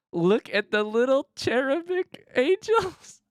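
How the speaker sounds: high, slow cooing voice; cooing at the art. Real, not acted. Streamer cooing at the card art. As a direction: coo over something adorable.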